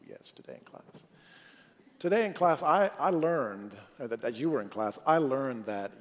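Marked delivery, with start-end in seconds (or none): 0.1-0.3 flüsternd, voller Angst; whispered dread. Retold or imitated. Child dreads father finding out. 0.3-1.0 an unvoiced whisper on "today in class", full of dread. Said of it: "Oh, yes".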